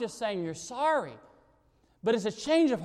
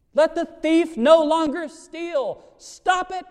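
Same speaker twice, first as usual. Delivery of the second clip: "Let the thief no longer steal" higher, louder and slow, "Stop it" barked; forceful repeat; 8 semitones higher, 6 dB louder, slower than usual. Shown as a demonstration. Preacher repeating the verse to drive it home, loud and commanding. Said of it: "there has to be the stop it".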